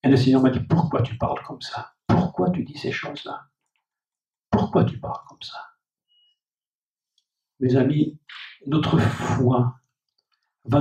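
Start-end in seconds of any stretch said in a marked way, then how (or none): 0.6-5.6 hushed divine voice. Acted. God speaking to the preacher.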